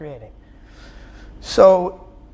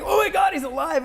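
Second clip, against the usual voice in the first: startled cry; 11 semitones higher than usual. Retold or imitated. Paramedics shocked to see crash victim alive, high startled shout. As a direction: blurt it out like you just saw a dead man breathe. EMTs find him alive.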